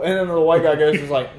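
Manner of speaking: normal talking voice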